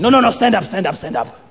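rapid-fire sensei correction; Mister Miyagi. Retold or imitated. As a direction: correct the pupil in short bursts. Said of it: "and he's like".

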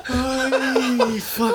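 slow squeaky laughing exclamation; wheezing through tears of laughter; horrified amusement. Real, not acted. Laughing fit.

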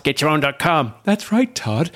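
punchy radio host catchphrase, pitch jumping up on right; a light take on a podcast host's tagline; Todd impression. [name] mimicking Todd's catchphrase for James, lightly voiced. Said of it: "where I impersonate, uh, Todd and Rob".

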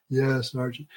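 barely enough breath left to refuse; weak, drained reply. Retold or imitated. Parched trainee answering the instructor offering beer, drained and soft.

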